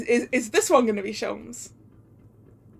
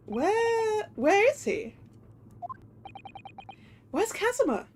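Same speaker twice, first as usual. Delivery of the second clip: much higher, faltering dread; anxious questioning; 10 semitones higher, slower than usual. Acted. Accused man asking after Kazuma, anxious. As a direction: ask high, as if fearing the answer. Protagonist asks after dead friend.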